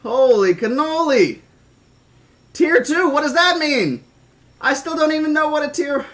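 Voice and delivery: high, loud amazement; astonished exclamation. Real, not acted. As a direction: blurt it out, stunned by the gift. Stunned by another gift subscription.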